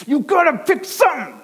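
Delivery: high, loud exasperated father; a father at his wits' end, pitched high; fed-up father. Retold or imitated. Father pleading with his toddler at dinner, high loud exasperation. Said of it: "but he's like".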